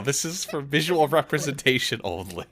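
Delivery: very loud, high disclaimer; high, booming comic objection; mock exasperation. Real, not acted. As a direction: shout the disclaimer in playful protest. GM mock-protests over the battle map.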